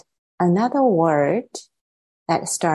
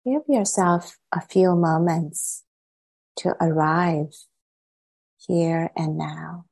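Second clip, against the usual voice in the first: unhurried meditation guidance, long silences between phrases; guided meditation. Acted. Teacher settling the group into meditation, calm and spacious. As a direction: guide them calmly, softening as phrases settle.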